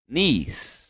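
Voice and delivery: Niece said quietly with a long ee, neece; soft pronunciation demo. Shown as a demonstration. Teacher models Niece softly for class. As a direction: let Niece fade softly into the S. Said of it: "Bem fraquinho aqui o, o E".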